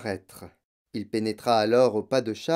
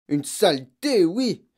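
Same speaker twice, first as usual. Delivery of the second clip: loud, high scornful cry; exclaiming; 5 semitones higher, 4 dB louder, slower than usual. Acted. Father cursing an American book, loud exclamation. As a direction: spit out the insult. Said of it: "s'écria monsieur Verdebois".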